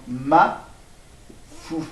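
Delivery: Mafouf drawn out very slowly, syllable by syllable; slow dictation. Shown as a demonstration. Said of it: "Il y a juste le H". (dicter Mafouf très lentement, syllabe par syllabe)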